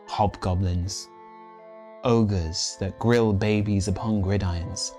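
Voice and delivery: ordinary delivery, nothing marked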